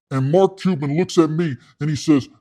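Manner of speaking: ordinary talk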